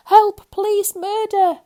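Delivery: very high, very loud screaming; terrified scream. A narrator's reading. Mister Jelly screaming for police as a leaf falls on him. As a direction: scream for help. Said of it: "he screamed".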